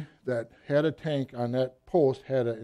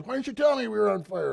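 higher-pitched, indignant mock demand; retold angry demand; 7 semitones higher than usual. Commander demands why nobody reported the fire, indignant. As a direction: act out an exasperated commander's complaint.

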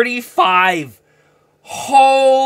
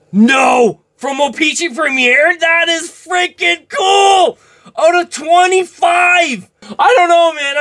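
high, loud and slowed in disbelief; losing it over a rare card; real excited yelling; worked-up shouting; 7 semitones higher, 3 dB louder than usual. Real, not acted.

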